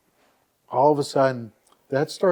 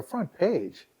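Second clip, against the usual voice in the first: higher-pitched, disbelieving echo; astonished echo; 4 semitones higher than usual. Retold or imitated. Website owner reacting to the reporter's front page news, astonished. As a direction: echo it back in amazement, pitched up.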